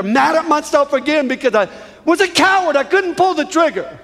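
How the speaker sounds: impassioned testimony of self-anger; angry at himself. Retold or imitated.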